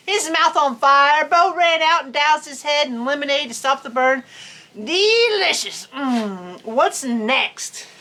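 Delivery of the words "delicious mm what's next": savoring character voice. Acted. Armadillo savoring the jalapeno.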